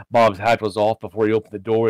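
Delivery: usual speaking voice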